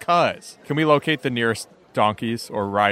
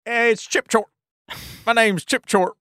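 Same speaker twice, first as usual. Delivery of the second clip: high excitable shopkeeper voice; chirpy fast-talking merchant; high character voice; 8 semitones higher than usual. Acted. Player voices Chip.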